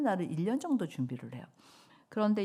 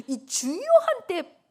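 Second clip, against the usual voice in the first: mock exasperation; 13 semitones higher, 9 dB louder, slower than usual. Exasperated retold protest.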